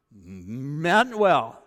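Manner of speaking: normal talking voice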